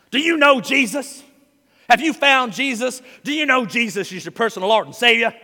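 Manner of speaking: hammed-up Bible-shaking evangelist; mock preaching at you. Pastor imitating preachers shaking Bibles in your face, mock loud. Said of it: "shake it in your face".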